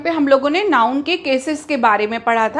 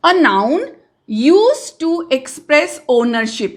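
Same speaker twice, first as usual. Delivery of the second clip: dictating slowly; much slower than usual. Shown as a demonstration. Teacher dictating a definition.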